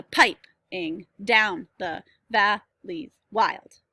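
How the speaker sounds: slow scansion, PI-ping DOWN the VAL-leys WILD; trochaic stress. Shown as a demonstration. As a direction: hit the first syllable of each foot in "piping down the valleys wild". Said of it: "You stress first".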